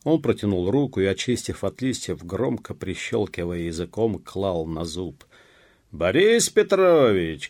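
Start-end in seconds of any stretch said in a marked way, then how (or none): 5.9-7.5 loud, high, drawn-out call; calling out loudly. A narrator's reading. Searcher calling for Борис Петрович, loud drawn-out shout. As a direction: call the name out, stretched. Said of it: "крикнул он опять".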